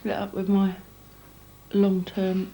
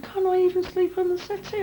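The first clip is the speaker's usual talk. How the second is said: high, put-on pleading voice, much higher; mocking his whine; 12 semitones higher than usual. Mimicking the boyfriend asking to stay, mocking whine. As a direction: send up the boyfriend's begging. Quoting boyfriend's plea in row.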